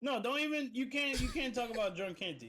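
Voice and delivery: high, loud, insistent objection; indignant protest. Real, not acted. Friend objecting to the drunk host's denial, indignant. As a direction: cut in indignantly, voice jumping up.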